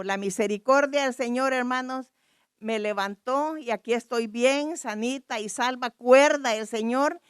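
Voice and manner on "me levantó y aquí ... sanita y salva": usual speaking voice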